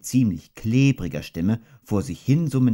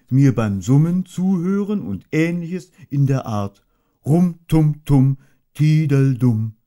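gentle humming bear, pleased with his idea; Pooh Bear voice; 3 semitones higher, 4 dB louder than usual. Acted. Pooh hums and muses aloud.

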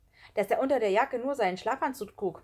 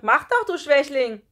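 loud, high, mocking dare; scornful schoolboy taunt; shouted retort; 7 semitones higher, 12 dB louder than usual. A narrator's reading. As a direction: jeer back at full voice. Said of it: "rief Kai zurück".

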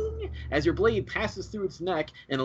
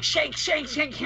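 high, gleeful stabbing chant; overacted kobold; 11 semitones higher, much slower than usual. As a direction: ham up a kobold shanking. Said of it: "he's overacting now".

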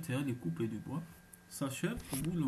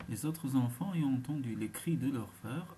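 plain reading voice

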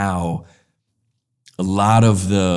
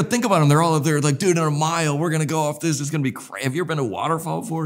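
high-pitched, giddy retelling; imitated excitement, pitched well up; excited water particles; 9 semitones higher, faster than usual. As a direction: act out water drops buzzing before the big plunge. Acting out water particles' journey.

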